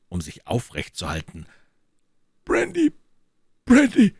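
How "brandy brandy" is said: high, loud, breathless gasps for brandy; a dying man's gasping plea. A narrator's reading. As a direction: pant it out, desperate for air. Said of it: "keuchte er".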